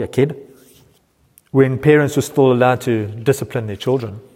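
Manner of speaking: ordinary talk, nothing marked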